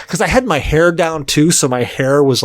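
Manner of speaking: normal talking voice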